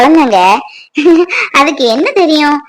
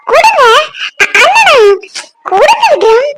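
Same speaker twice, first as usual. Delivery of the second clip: high-pitched, excited urging; eager demand; 8 semitones higher than usual. Acted. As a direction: clamour for the phone. Eager to grab phone from husband. (ஆவலோடு உயர்ந்த குரலில்)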